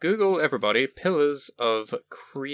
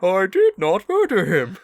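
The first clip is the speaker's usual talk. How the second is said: bad robot impression; 8 semitones higher, 6 dB louder than usual. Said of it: "that's an inside joke".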